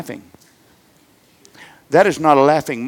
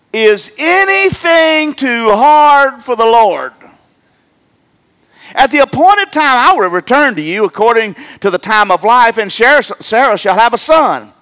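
scripture read with fervour; 6 semitones higher, 5 dB louder than usual. Acted. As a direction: lean on each word like a pulpit reading. Reading God's words aloud.

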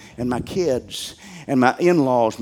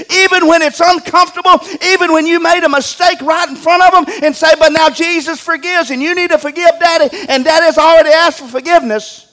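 higher and louder, urging the flock; preaching at full force; 9 dB louder than usual. Acted. Pastor urges parents to get to church, preaching hard. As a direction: lean into it like a revival preacher.

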